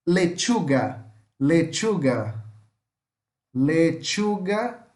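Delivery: slow "lechuga" with a T before the sh; careful model of "lechuga", tch in the middle. Shown as a demonstration. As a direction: draw out "lechuga" as le-TCHU-ga. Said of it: "pusesse um T aqui na frente e falasse com o som de X".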